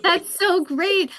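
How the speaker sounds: delighted praise through laughter; high, loud, drawn-out laughing approval. Real, not acted. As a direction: gush it while still laughing. Laughing at the guest's story.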